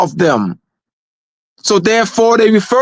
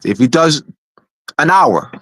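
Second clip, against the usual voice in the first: ordinary talk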